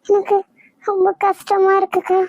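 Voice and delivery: rising distress, almost crying; tearful pleading. Acted. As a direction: plead with your sister, wounded. Sister rejected, pleading tearfully.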